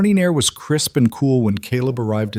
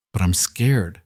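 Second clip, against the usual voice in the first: small frightened voice; slower than usual. Acted. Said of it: "so small, so frightened".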